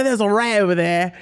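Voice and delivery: clumsily imitated Australian accent; bad Aussie accent. Player imitating assistant warning about a rat, bad Aussie accent. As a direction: have a go at a broad Aussie accent. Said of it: "Aussie accent is the worst".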